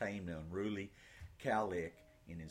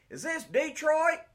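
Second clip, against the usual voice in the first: soldier yelling; 11 dB louder than usual. Acted. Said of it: "A soldier yelled from the truck".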